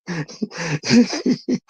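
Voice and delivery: long wheezy chuckle; helpless private laughter; amused laugh. Real, not acted. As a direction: crack up at your own joke. Amused by the chatter's claim.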